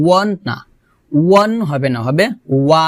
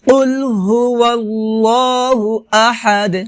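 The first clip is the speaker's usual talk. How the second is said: higher, slow held recitation; Quran recitation; 4 semitones higher, slower than usual. Shown as a demonstration. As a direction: chant the verse with long level vowels. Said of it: "আবার".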